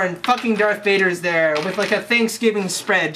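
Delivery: ordinary talk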